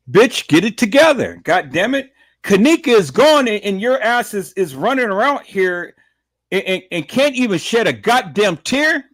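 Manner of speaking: angry scolding. Retold or imitated. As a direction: shout it at her like the sister would have. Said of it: "she was trying to tell you".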